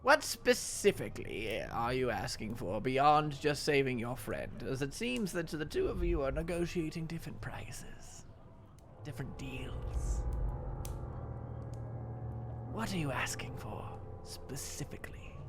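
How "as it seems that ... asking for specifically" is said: put-on hushed sly old witch; whispering. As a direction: breathe the question softly. Hag hushes her questions.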